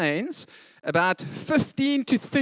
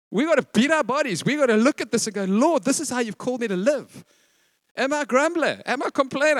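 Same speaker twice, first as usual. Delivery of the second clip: loud, high, driving sermon voice; preacher urges the congregation, rising and loud; fiery preaching; 6 semitones higher, 4 dB louder than usual. Acted. Full-force preaching.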